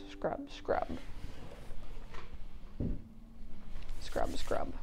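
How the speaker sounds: whispered scrub scrub. Acted. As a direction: breathe it out softly. Whispered song refrain.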